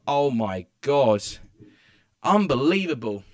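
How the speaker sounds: real shout of disbelief at a late goal; a cry of disbelief loud enough to scare the family; loud outburst. Real, not acted. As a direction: let it burst out, loud and stunned. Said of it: "I think I've scared my family doing that".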